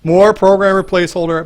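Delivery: loud, exasperated mock outburst; mock hissy fit. Teammate reacting to the programmer's new menu, mock hissy fit. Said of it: "throw a hissy fit and be like".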